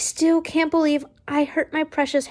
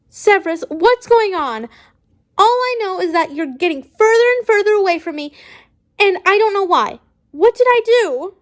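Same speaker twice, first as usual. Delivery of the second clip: higher, louder pleading that builds toward a shout; shouting in distress; 6 semitones higher, 4 dB louder than usual. Acted. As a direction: demand answers from him, rising to a shout. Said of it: "he shouts".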